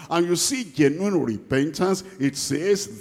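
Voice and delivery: ordinary delivery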